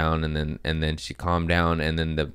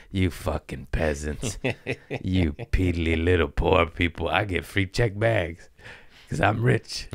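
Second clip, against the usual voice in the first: mock snob. Mocking snob voice at peasants.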